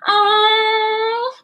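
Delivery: put-on high, sing-song whining child; little dinosaur pleading to go swimming. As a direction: beg Mummy in a drawn-out whine. Child begging mother dinosaur.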